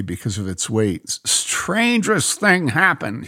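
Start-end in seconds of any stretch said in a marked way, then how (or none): 1.2-3.3 slow, wide-eyed and higher; a man stunned by what he saw; excited. A narrator's reading. Bob tells the lady about the punch bowl, excited. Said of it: "he said in an excited voice".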